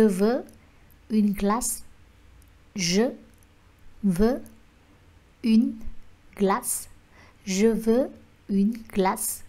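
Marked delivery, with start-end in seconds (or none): none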